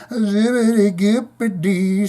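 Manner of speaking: high, slow showman patter; drawn-out, high stage voice. Acted. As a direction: scat it out to the crowd. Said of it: "Mister [name] addressed [name]'s claim, telling the crowd".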